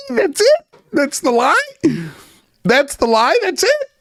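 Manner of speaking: high, squeaky disbelief; mock incredulous squeal. Mocking Corbell, squealing in disbelief.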